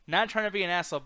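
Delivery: ordinary talk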